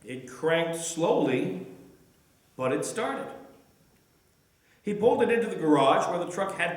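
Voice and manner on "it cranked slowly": ordinary delivery, nothing marked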